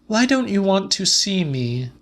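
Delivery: high, pleading Celia; Celia's voice. A narrator's reading. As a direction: lift up and plead. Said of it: "said Celia".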